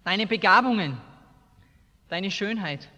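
usual speaking voice

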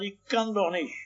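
put-on small, high, soft child's whimper; imitated tearful boy. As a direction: mimic a crying little boy, quietly. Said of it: "der Junge heult und sagt". (nachgeahmter heulender Junge, hoch und leise)

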